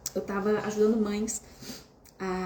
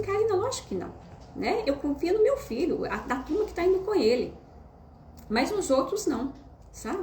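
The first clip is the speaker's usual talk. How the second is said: higher, indignant mother voice; imitating the mother; 8 semitones higher than usual. Mother answering Karina, mock indignant and higher. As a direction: play the mother, sure of herself. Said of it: "Ela falou assim".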